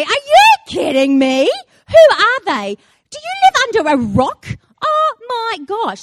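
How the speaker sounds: high, loud, scandalised fan; hammed-up indignant shouting; incredulous outrage. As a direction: act appalled at such ignorance. Acted outrage at husband's ignorance.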